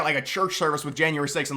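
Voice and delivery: ordinary delivery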